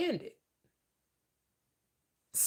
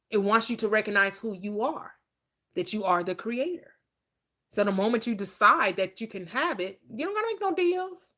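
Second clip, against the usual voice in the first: ordinary delivery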